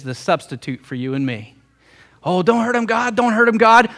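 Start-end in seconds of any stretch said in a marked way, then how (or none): 2.2-3.9 high-pitched, acted begging; urgent pleading. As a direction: plead as a protector stepping in. Acting Jesus pleading to angry Father.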